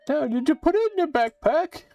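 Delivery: high, loud cackling witch casting a spell. Acted. Witch cursing the player into a frog, high and gleeful.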